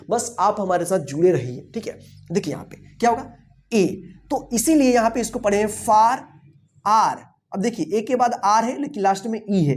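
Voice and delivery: ordinary talk, nothing marked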